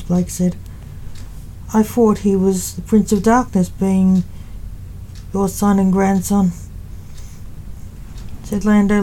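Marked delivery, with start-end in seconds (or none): none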